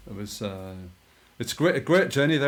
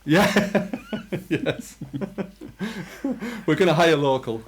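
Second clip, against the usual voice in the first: laughing; 7 semitones higher, 4 dB louder than usual. Real, not acted. Laughing joke about hiring.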